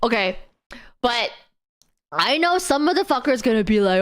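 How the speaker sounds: ordinary delivery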